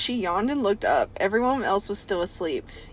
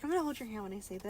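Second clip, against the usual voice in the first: much softer, fast tender mockery; mock gentle; 15 dB quieter, much faster than usual. Parodying a gentle bearer of bad news, much softer. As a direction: murmur it like breaking bad news softly. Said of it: "he's like".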